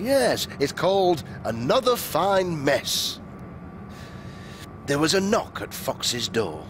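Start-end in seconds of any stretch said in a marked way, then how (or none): none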